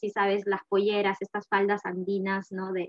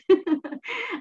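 laugh; 10 semitones higher, 4 dB louder than usual. Real, not acted. Laughs at her own joke.

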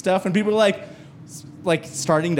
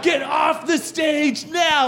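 high, slow, shouted warning; alarm shout; 9 semitones higher, slower than usual. Shown as a demonstration. Teleprompter ordering the comic off, high shout. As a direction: read it out like a flashing alarm. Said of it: "it says".

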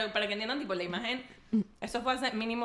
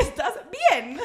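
laughing mock concern; 9 semitones higher, 8 dB louder, much slower than usual.

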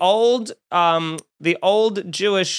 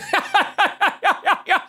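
shrill giggling laugh, far higher; high cackling laugh; high mock laugh; 13 semitones higher than usual. Old man laughing at the flashlight, high mock laugh. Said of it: "He's going".

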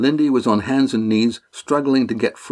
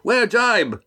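much higher warning call; shout; 11 semitones higher than usual. A narrator's reading. Estrella warning the ship, high urgent shout. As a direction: cry it out high to everyone aboard. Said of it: "he shouted".

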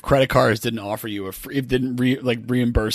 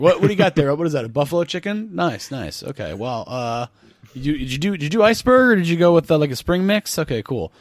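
higher, chatty salad-bar small talk then pitch; mock Clear salesman; 5 semitones higher than usual. Clear rep chatting up a customer at the salad bar, fake-friendly. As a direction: schmooze about lunch before the hard sell. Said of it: "It's like".

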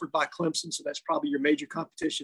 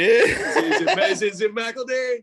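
laughing question; giggling while asking; 4 semitones higher, 5 dB louder than usual. Real, not acted. Joking about the name.

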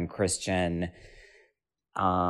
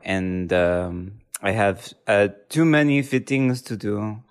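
comic, halting Russian accent; slower than usual. Shown as a demonstration. Showing off dialects to co-host, comic Russian accent. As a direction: put on a hammy Russian accent, drifting toward French. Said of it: "I'll do it with my Russian accent".

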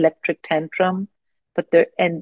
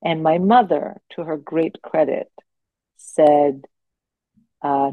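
ordinary talk, nothing marked

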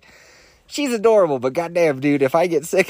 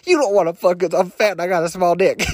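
a hammed-up angry outburst, only lightly raised; mock yell; 5 semitones higher than usual. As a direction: play the insecure guy snapping, half-serious. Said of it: "yelling at a girl".

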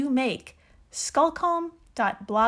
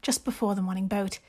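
put-on British accent, measured and genteel reading; Jane Austen voice. Host performs an island blog entry for co-host, in a British accent. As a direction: read it in an amateur British accent, pausing between phrases. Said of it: "in your best Jane Austen voice".

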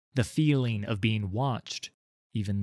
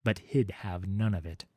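plain reading voice

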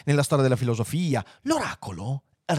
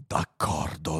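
low, soft agreement; grave computer; 9 semitones lower, 6 dB quieter, much slower than usual. Acted. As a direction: agree quietly, deep in the voice. (assenso basso e solenne)

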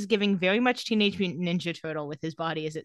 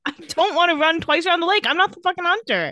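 higher and louder, fed-up whining; exasperated Matt; 9 semitones higher, 5 dB louder than usual. Pretend. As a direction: protest like a tired teen who won't run. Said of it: "If I'm Matt, I'd be like".

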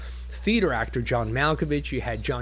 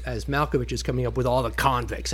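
normal talking voice

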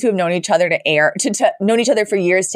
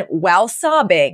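usual speaking voice

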